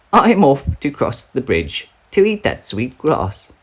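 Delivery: a bigger goat setting off confidently; middle-sized billy goat. Acted. Middle goat announcing he will cross, medium high.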